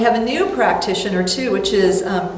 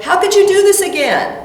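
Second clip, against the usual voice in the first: high, louder scolding of herself; harsh self-reproach; 9 semitones higher, 5 dB louder than usual. Retold or imitated. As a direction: scold yourself sharply. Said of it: "I would have been saying that".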